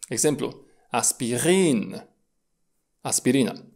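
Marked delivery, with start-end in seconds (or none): 0.9-2.1 "aspirin" with a slow, long final i. Shown as a demonstration. Teacher models long I, "aspirin" with drawn-out last syllable. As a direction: stretch the end of "aspirin", "aspi-riiin". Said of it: "Se mai pronunță lung înaintea".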